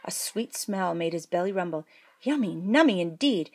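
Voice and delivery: usual speaking voice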